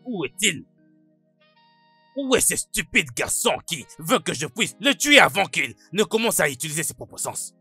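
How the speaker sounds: loud, high furious demand; angry shouting. Acted. Man hunting the stupid boy he threatens to kill, angry shouting.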